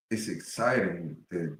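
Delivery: normal talking voice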